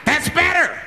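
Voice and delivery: high, sudden outburst as the man; crazed approval. Acted. Gunman-like man approves the quiet.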